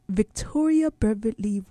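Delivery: attempted British accent; amateur British accent on the name. Shown as a demonstration. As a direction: try the name in a British accent, not quite convincingly. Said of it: "if you said that in a British accent".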